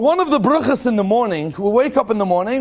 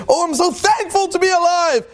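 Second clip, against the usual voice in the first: much higher, bright thankful exclamation; mock gratitude; 9 semitones higher than usual. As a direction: gush like someone thrilled to wake up. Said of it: "we say".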